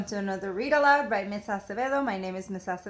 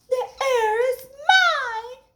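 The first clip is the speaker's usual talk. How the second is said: very high, slow, loud scream; high drawn-out shriek; 8 dB louder, slower than usual. Acted. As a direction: shriek it as a small frog. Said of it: "screamed Lydia".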